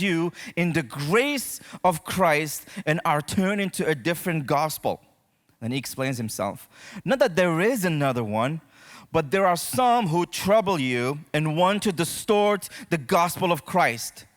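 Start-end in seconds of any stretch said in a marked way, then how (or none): none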